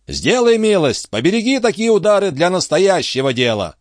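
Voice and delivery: high-pitched testy character voice; irritated retort. Acted. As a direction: scold him in a high voice. Antifer's acted retort to Tregomain. (высокий раздражённый голос)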